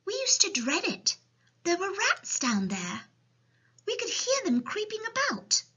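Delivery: put-on high little girl voice; child's storybook voice; Sophie. A narrator's reading. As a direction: explain as a young orphan, high-pitched. Sophie tells of rats.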